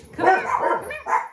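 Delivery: loud, high-pitched summons; calling puppies over. Real, not acted. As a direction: call the dogs to you, high and loud.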